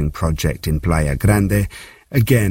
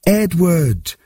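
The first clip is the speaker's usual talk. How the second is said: high, drawn-out call falling away; restrained reader's version of a desperate yell, high-pitched; narrated yell; 12 semitones higher than usual. A narrator's reading. Boy searching collapsed towers for his brother, high calling yell. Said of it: "his youthful voice yelled out at the top of his lungs".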